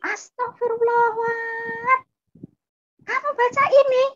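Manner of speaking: very high-pitched outraged scream, imitating older sibling; shocked yell. Older sibling catches younger one reading the Bible, shocked high yell. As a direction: shriek it in horror. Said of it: "Langsung kakak saya teriak".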